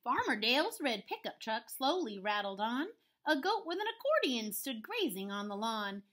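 lively, swinging storybook narration; story read-aloud. A narrator's reading. Teacher reading a rhyming farm story to pupils, lively read-aloud. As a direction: bounce through the rhyme for young listeners. Reads picture book to children.

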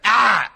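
high, loud cry of pain; yelp of pain. Retold or imitated. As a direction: yelp like you've just been hit. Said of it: "he went".

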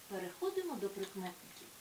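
ordinary talk

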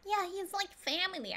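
high-pitched mimicry of a sitcom character; Janice from Friends impression. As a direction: mimic her distinctive voice, pitched well up. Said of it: "reminds me of Janice from Friends".

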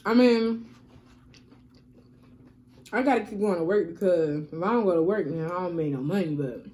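talking while chewing nachos. Real, not acted. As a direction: mouth partly full of food. Seen 4: Picks nachos from the box; lips pressed, chewing between bites throughout.

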